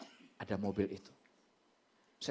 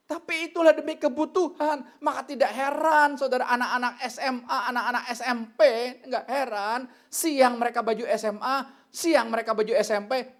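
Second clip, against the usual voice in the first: loud, high-pitched preaching; raised, driving sermon voice; full-force preaching; 9 semitones higher, 8 dB louder than usual. Real, not acted. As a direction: preach it hard to the congregation.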